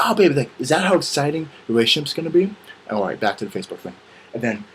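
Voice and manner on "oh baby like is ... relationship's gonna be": high, whispery mock gushing; gushing girlfriend voice. Girlfriend reacting to cereal news, mock gushing. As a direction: gush it high and airy.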